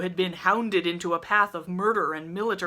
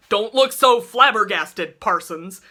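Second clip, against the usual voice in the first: loud booming voice; triumphant loud boom; 4 semitones higher, 9 dB louder than usual. A narrator's reading. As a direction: boom it across the church. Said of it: "he boomed".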